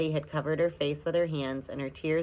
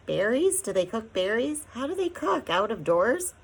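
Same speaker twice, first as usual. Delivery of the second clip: anxious questions; 7 semitones higher, 5 dB louder than usual. A narrator's reading.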